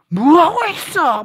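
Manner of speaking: high, loud, drawn-out yell; slow, high holler of exasperation; acted scolding shout. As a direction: yell it impatiently, stretching the words.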